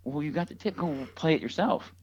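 a hint of a stern parent. Acting parent scolding.

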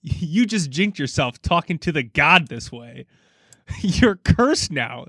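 slow, hammed-up reading of an all-caps tweet; emphasizing the caps. Reading out [name]'s cursing tweet, slow and emphatic. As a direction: lean on the capital words, slowly. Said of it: "I'm trying to emphasize the caps".

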